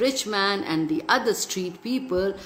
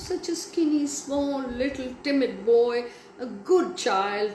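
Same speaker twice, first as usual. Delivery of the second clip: usual speaking voice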